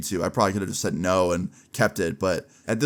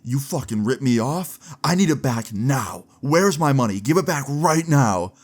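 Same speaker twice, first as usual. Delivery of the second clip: higher, slow and emphatic mock fury; retold angry demand; 4 semitones higher, slower than usual. Cheated classmate up in his face about money, retold anger. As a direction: act out a mad classmate demanding payback. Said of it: "he gets real mad".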